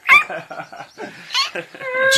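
big mocking guffaw; loud laugh. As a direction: burst out laughing loudly. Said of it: "Giraffe laughed".

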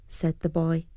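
usual speaking voice

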